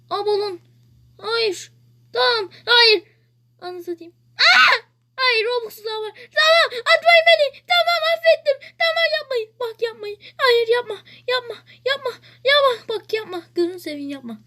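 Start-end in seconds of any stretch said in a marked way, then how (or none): 4.4-12.7 high frantic begging shouts; panicked pleading. Real, not acted. Begging other players not to throw him.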